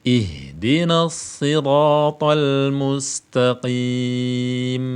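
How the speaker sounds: slow, drawn-out chanted recitation; slow Quran recitation. Shown as a demonstration. Teacher recites the verse slowly for students to copy.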